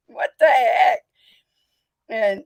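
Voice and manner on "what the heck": loud, startled "what the heck"; mock shocked shout. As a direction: throw it out like baffled locals. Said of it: "everybody's like".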